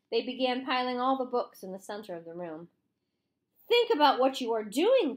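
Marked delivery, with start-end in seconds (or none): 3.7-5.2 pleading cry, high and loud. A narrator's reading. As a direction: cry out to stop the mob. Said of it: "cried Miss Catherine".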